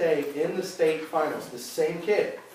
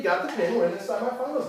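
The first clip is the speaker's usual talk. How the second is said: usual speaking voice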